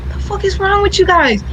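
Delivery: high, incredulous scolding; baffled at teachers who don't know; exasperated disbelief. Retold or imitated. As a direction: rant in mock outrage. Retold rant at teachers.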